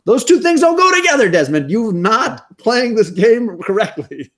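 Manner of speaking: squeaky mock lecture to a fictional soldier; mock scolding. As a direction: scold Desmond like a fed-up parent. Mock scolding Desmond in high voice.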